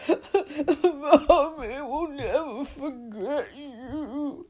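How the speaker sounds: high, loud, drawn-out wobbling wail; sobbing wail. A narrator's reading. Gamekeeper mothering his departing dragon, sobbing wail. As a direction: wail it slowly through hammed-up sobs. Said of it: "Hagrid sobbed".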